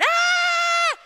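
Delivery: loud high mock roar; a big loud yell to scare off a bear. Hikers yelling together at the bear, loud mock roar. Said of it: "everybody else is going".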